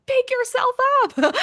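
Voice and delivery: high, loud pep-talk to herself; a cheerleader yelling at the character; urging herself on. Retold or imitated. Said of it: "I was like".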